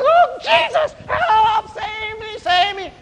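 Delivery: very high, frantic cries for help; panicked scream. Acted. Old man with bear at his heels, high panicked scream. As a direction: shriek for rescue, high and desperate. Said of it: "The old man".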